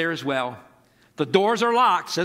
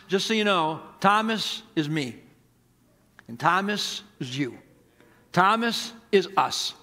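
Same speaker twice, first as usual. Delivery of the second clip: ordinary talk, nothing marked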